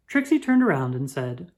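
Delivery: ordinary delivery, nothing marked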